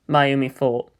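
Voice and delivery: ordinary delivery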